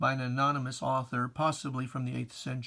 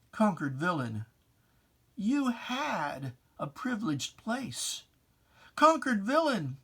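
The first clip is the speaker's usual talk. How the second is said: solemn, dramatic verse reading, pitched higher; poem recitation; 6 semitones higher, 5 dB louder than usual. Acted. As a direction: recite it like a sermon in verse, swelling on each image. Recites his own poem.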